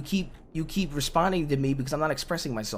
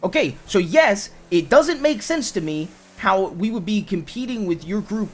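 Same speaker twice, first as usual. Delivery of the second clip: loud, higher, slow emphatic argument; frustrated insistence; 4 semitones higher, 6 dB louder, slower than usual. Real, not acted. Arguing with partner about competing groups, frustrated insistence. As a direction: press the point hard and slowly.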